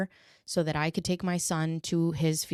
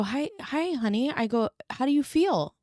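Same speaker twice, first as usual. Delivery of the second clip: higher, sweet coaxing tone; gentle mom voice; 9 semitones higher than usual. Retold or imitated. Mother gently checking on her toddler.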